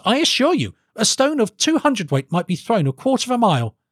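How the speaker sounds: high-pitched urgent protest; pleading cry to a king; alarmed. Acted. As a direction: insist in alarm, voice jumping up high. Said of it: "cried Felix, alarmed".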